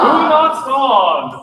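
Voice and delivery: loud, slow, stress on not; a big boomy presentation voice, aged up; boomy presenter. Shown as a demonstration. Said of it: "big, boomy voice ... doing a presentation".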